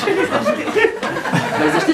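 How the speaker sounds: high, slow mock-sweet pitch; hammed-up salesman squeal; pushy salesman. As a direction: coax the buyer in a high drawn-out voice. Pushy salesman's closing line.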